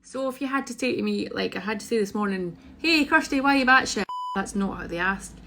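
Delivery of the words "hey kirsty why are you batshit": mock blunt question.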